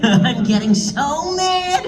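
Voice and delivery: high, drawn-out pretend fury; hammed-up mad voice breaking into laughter; mock anger. Joking about being mad, laughing.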